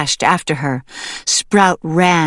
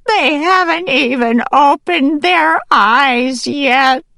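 high, slow, loud desperate plea; pleading, trembling; 10 semitones higher, 5 dB louder than usual. A narrator's reading. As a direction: beg for the babies in a trembling voice. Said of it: "her voice trembling".

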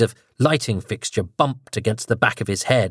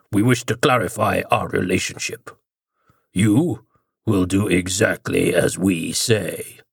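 growling deeper voice; 3 semitones lower than usual. Acted. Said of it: "growling edge to it and a much deeper register".

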